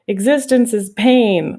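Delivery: a hint of a cartoon character lamenting, drawn out; Mister Meeseeks. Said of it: "you've seen the Mister Meeseeks episodes".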